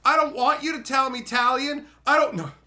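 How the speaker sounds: loud, high, dismissive mock voice; mocking Kellen. Ranting about the ending, loud mocking Kellen. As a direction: lampoon a character who shrugs it off. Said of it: "Kellen goes".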